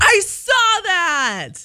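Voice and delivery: high, drawn-out squeal of recognition; long high excited I saw that. Real, not acted. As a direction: stretch it out high and thrilled. Excited reaction to viral story.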